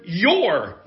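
"your" hit loud and high, falling away; emphatic, drawn-out "your"; stress on your. Shown as a demonstration. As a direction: punch the word "your". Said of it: "I want to emphasize the word".

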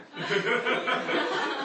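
airy, near-silent laugh; breathy giggle. Real, not acted. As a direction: laugh under your breath. Laughs at the story.

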